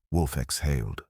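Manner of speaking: ordinary delivery, nothing marked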